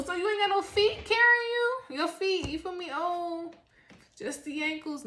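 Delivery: high, loud disbelieving scold; incredulous. Acted. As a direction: scold them in mock disbelief. Mock scolding of forgetful people.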